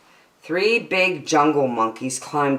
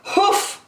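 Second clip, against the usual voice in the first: high breathy huff; a scornful high huff; mock kangaroo huff; sharp hoof. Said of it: "hoofed a voice".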